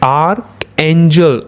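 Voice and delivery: Arc angel said slowly, each part drawn out; teacher sounding out arc angel slowly for students. Shown as a demonstration. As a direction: spell it out slowly for the class, arc then angel. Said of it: "ये हो गया आर".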